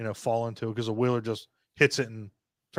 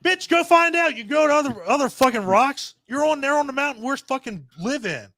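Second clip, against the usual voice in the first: much higher and louder ranting; fed-up shouting at the king's lackey; exasperated yell; 11 semitones higher, 3 dB louder than usual. Real, not acted. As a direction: chew out the dumb minion. Scolding the character himself.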